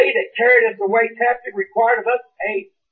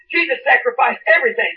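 normal talking voice

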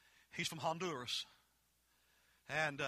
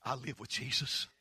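ordinary talk, nothing marked

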